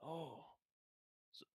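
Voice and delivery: normal talking voice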